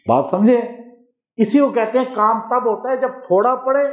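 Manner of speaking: normal talking voice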